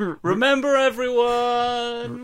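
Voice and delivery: loud, high, drawn-out call; shouting. Acted. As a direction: call out to everyone, stretching it. Outro catchphrase shouted.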